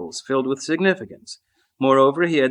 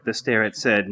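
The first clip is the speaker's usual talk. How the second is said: ordinary delivery, nothing marked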